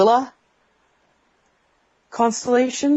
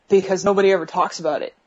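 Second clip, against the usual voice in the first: usual speaking voice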